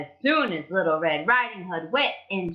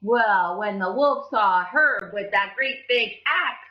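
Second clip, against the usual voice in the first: usual speaking voice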